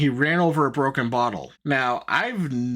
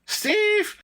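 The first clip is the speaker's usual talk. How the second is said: high, drawn-out mock call; mock yelling; much slower than usual. Podcaster imitating the girlfriend yelling for her boyfriend, high mock call. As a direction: imitate calling out a name, pitched way up. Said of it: "and she's yelling".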